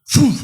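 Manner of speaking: mimicked defibrillator jolt, loud; loud fum for the shock. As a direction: punch the electric jolt out as a noise. Imitating the second shock.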